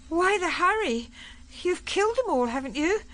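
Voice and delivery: put-on shaken young woman. Polly questioning the general after the killing, high and shaken. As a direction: pitch it right up, questioning and upset.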